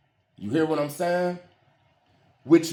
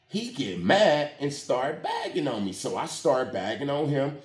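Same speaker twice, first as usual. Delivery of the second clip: ordinary talk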